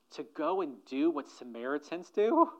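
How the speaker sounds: normal talking voice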